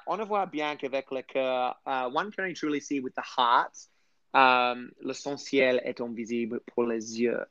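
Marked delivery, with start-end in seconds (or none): 0.1-1.7 French quote in a careful French accent; best French accent. Shown as a demonstration. Repeating the quote to translate, in French accent. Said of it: "that's my best accent". 5.0-7.4 softer, careful French accent; best French accent. Shown as a demonstration. Repeating the quote to translate, in soft French accent. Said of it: "that's my best accent".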